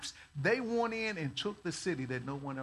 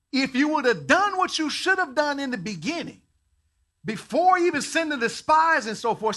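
stern loud rebuke; 7 semitones higher, 8 dB louder than usual. Retold or imitated. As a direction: scold like an impatient father. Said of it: "Modern day translation".

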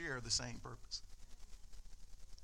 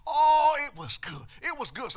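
high, loud gush of excitement; mock-thrilled friend raving about a sermon; excited friend; 10 semitones higher, 11 dB louder than usual. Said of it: "he's so excited".

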